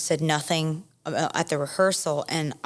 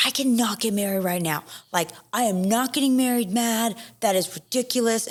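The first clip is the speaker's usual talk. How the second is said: higher-pitched, mock-indignant refusal; retold angry protest; 6 semitones higher than usual. Bride refusing to marry mad, replayed in high indignation. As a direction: act out digging your heels in, without shouting. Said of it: "I'm, like, yelling outside".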